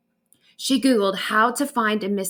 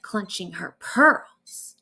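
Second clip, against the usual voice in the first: ordinary delivery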